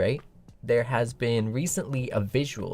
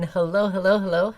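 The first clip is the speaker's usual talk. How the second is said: ordinary delivery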